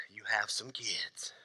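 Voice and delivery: slow, hushed whisper. Real, not acted. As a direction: drop it to a slow whisper like a secret. Punchline dropped to a whisper.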